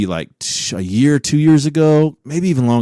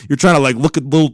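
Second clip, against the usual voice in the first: usual speaking voice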